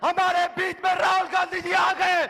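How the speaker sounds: shouted welcome to the crowd; announcer shout. Real, not acted. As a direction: proclaim it to the rally. Announcing the guest.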